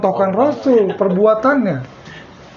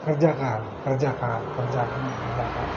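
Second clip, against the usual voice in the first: softer, lower repeated urging; soft urging teacher; 9 semitones lower, 11 dB quieter than usual. Retold or imitated. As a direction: quietly coax the student to get to work. Teacher urging the student to practise. (guru mendorong murid, suara pelan dan rendah)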